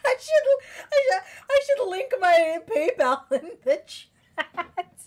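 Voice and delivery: high, helpless laughing while talking. Real, not acted. Streamer joking to chat about a PayPal link, laughing. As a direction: struggle to get the words out through laughter.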